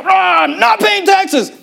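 slow, high mock cheer of the tax rebels; mock rallying cry. Preacher mocking tax protesters with a slow, high cheer. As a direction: ham up a drawn-out protest chant.

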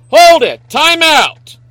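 high, loud call to halt; re-enacted shout of protest; retold outburst. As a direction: shout it out to stop everything. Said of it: "and I went".

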